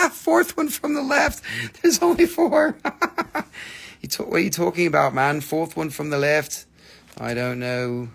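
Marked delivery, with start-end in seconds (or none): 0.1-2.9 high, loud incredulous laugh through the words; cracking up at a silly comment; laughing disbelief. Real, not acted. Laughing at a viewer's joke comment, high and loud.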